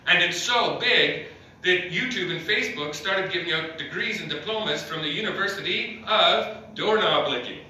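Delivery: ordinary delivery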